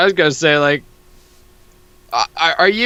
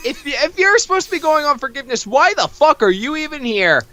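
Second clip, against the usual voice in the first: cartoon character's high-pitched outrage; squeaky outraged character; 14 semitones higher than usual. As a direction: squeal it out in disbelief. Voicing comic character's outburst.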